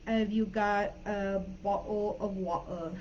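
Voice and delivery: slow Cockney demo with glottal-stop t's; Cockney accent. Shown as a demonstration. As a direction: drop the t's like a Cockney Londoner. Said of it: "Cockney accent. Another example would be".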